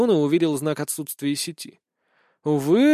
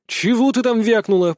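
raised, louder angry challenge; growled retort; 7 semitones higher, 5 dB louder than usual. A narrator's reading. Boy answers being called a fool, growling angrily. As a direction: growl it back as a threat. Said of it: "прорычал я".

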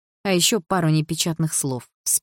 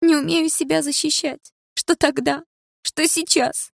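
much higher, anguished; tearful outburst; 11 semitones higher, slower than usual. A narrator's reading. Дилара pours out her hurt, much higher. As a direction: let the pain break through.

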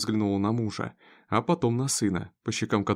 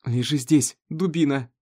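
tense, raised, angry order; through clenched teeth; 4 semitones higher, 4 dB louder than usual. A narrator's reading. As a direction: force it out through gritted teeth. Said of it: "процедила сквозь зубы Сара". (сквозь зубы, сердито)

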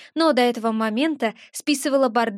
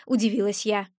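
ordinary delivery

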